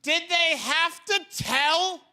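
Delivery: high-pitched comic exasperation; incredulous rant. Acted. Comedian questions telling a gorilla, incredulous. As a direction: push it up high and stay there, aghast.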